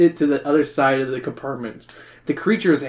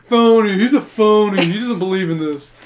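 Pinocchio voice; 4 semitones higher, 4 dB louder than usual. Acted. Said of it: "This isn't Pauly Shore anymore".

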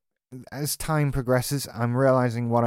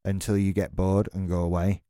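ordinary talk

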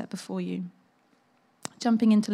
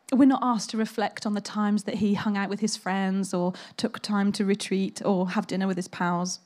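ordinary talk, nothing marked

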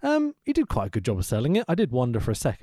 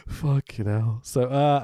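ordinary delivery

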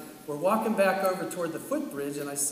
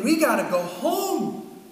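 high, slow, strained panic; panicked inner voice; 11 semitones higher, 7 dB louder, slower than usual. Retold or imitated. Father imagining telling his wife, high panicked voice. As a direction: voice climbing in dread, drawn out. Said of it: "and I'm thinking".

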